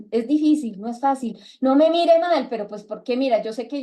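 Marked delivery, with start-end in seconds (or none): 1.6-2.5 mock warning. Reprende a quien la mira.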